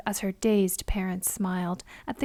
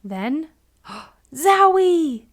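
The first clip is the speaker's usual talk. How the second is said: gasp, then a slow, loud, high zowie; gasp of surprise; 6 semitones higher, 9 dB louder, slower than usual. A narrator's reading. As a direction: stretch out the shock. Stink strikes again.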